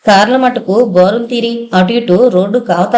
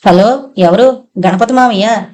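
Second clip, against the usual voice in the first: usual speaking voice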